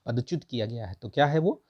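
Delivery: usual speaking voice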